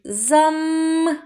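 "зам" ending in a long high hummed М; stretched last sound. Shown as a demonstration. Teacher sounds out the word, humming the М in "зам". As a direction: hum on the М of "зам". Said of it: "Звук м".

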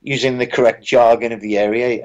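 ordinary delivery

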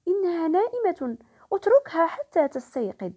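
put-on guard the sleeping doll like a little girl. Girl protects her sleeping doll.

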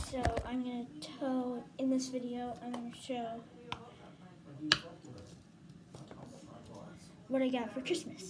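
talking with a retainer in; words round a retainer; wearing a retainer. Real, not acted. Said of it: "Let me put my retainer on".

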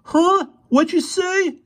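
very high, loud challenge; threatening. A narrator's reading. Boy challenges the muttering pair, threatening. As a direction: demand it as a menacing teenage boy. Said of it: "in a threatening voice".

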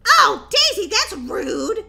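high, loud indignant cry; offended outcry. Acted. Girl called brain dead by her sister, indignant cry. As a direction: cry out as if insulted.

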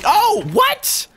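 shocked outburst. Real, not acted. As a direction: jolt up in pitch, caught completely off guard. Suddenly killed in a gunfight.

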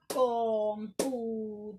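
computador slowly, one voice beat per syllable. Shown as a demonstration. Teacher showing students the voice beats in a word, syllable by syllable. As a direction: beat out "computador": com-pu-ta-dor, loud and separated. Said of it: "damos golpe de voz".

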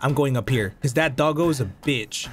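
ordinary talk

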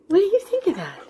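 high, coaxing dog-talk; coaxing pet talk. Real, not acted.

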